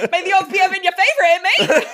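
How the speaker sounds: put-on high, rushed British accent with laughter; bad British accent. Quoting the Games motto in a bad British accent. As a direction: badly fake a British accent, giggling. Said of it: "I can't do a British accent very well".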